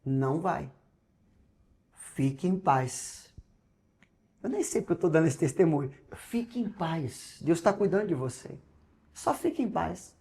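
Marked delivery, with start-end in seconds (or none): 0.0-3.3 soft and slow, the Holy Spirit calming him; calm inner voice. Retold or imitated. The Holy Spirit quietly telling him to stay calm, soft and slow. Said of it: "E o Espírito Santo assim". 6.2-10.2 calm reassurance. Retold or imitated. The Spirit reassuring him at the register, soft and tender. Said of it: "E o Espírito Santo assim".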